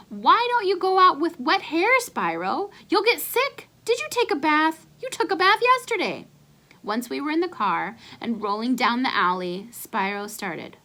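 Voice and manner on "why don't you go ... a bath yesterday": high, loud nagging voice; fussing mother. Acted. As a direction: nag like a worried mom calling out. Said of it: "Ma ... to call after us".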